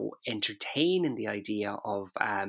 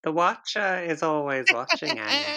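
mock spooky voice; 3 semitones higher than usual. Spooky persona teasing cohost.

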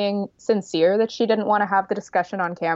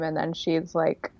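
normal talking voice

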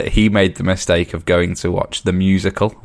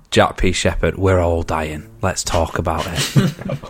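Joe Rogan impression; 4 semitones lower, 4 dB quieter, slower than usual. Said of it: "That'd be a proper Joe Rogan episode".

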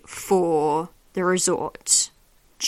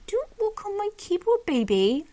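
much higher pet-talk voice; cooing scolding; 11 semitones higher than usual. Real, not acted. Podcaster to cat walking on the keyboard, cooing scolding. Tells cat off gently mid-sentence.

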